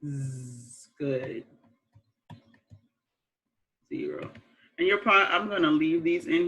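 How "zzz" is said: Z as a long soft buzz, "zzz"; "Z" said as a drawn-out, low zzz; isolated first sound. Shown as a demonstration. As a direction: hold the sound of "Z" without naming the letter. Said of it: "What is the first sound in zero?".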